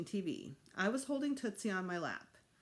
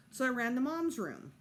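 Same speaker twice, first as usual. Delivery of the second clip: ordinary delivery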